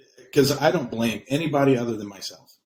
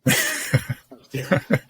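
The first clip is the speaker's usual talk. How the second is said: laughing through the words, pitched up; 7 semitones higher, slower than usual. Real, not acted. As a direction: laugh it out at yourself. Repeats line, second time laughing.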